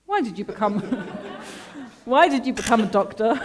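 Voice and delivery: laughing. Real, not acted.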